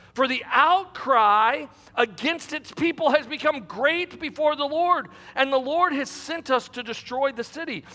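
dramatic scripture narration, lifted voice; scripture reading. Acted. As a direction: read the story out to a congregation. Resumes reading scripture aloud.

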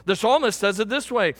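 ordinary talk

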